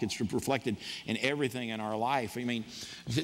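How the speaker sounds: normal talking voice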